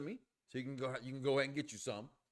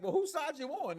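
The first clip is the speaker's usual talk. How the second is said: mock indignant woman; 11 semitones higher than usual. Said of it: "she was like".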